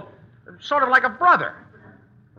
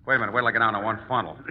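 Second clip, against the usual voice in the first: rushed aside, much lower; fast aside; 8 semitones lower, 4 dB quieter, much faster than usual. Real, not acted. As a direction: blurt it out quickly under your breath. Hasty aside before kneeling.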